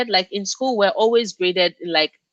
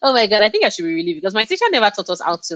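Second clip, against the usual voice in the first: rushed aside; 3 semitones higher, much faster than usual. Real, not acted.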